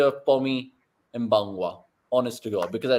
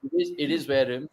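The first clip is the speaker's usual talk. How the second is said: ordinary talk, nothing marked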